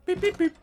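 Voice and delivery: three very high quick beeps; high, even machine pips; imitated keypad beeps. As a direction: mimic an electronic keypad. Imitating the three-digit keypad tones.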